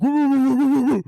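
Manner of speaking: high, loud yappy woo woos; imitated puppy barks. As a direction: bark like a puppy at its reflection. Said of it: "And he's like".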